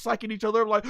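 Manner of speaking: usual speaking voice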